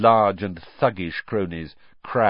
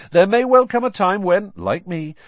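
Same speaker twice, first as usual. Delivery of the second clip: self-important celebrity; 8 semitones higher, 6 dB louder than usual. A narrator's reading. Lockhart voiced.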